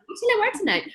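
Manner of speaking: pitched well up, wondering aloud to herself; high musing voice. Retold or imitated. Musing to herself about what to wear, high and light. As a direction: lift it high like idle self-talk. Voicing her own musings.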